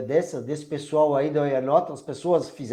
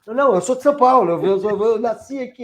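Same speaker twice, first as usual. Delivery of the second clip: quick, high, louder insistence; retold indignant protest; 6 semitones higher, 5 dB louder than usual. Passenger insisting on São Paulo birth, mock indignant. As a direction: imitate protesting, stumbling over the repeated word.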